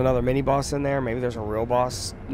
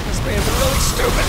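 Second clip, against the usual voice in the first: mocking taunt; 12 semitones higher, 8 dB louder than usual. Real, not acted. Taunting enemy in stealth game.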